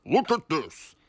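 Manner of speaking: loud stern boss voice; cross. A narrator's reading. Angry boss scolds.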